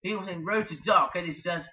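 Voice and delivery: ordinary talk, nothing marked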